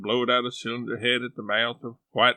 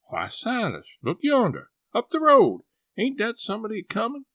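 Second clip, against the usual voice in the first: high, loud, then fast; sly aunt calling out excitedly; 10 semitones higher, 4 dB louder than usual. Acted. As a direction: point up the road to distract him. Sally feigns seeing someone.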